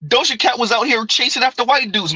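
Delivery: ordinary talk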